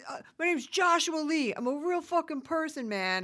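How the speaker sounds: mock indignant London. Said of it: "And Lon goes".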